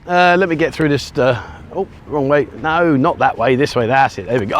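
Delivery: ordinary talk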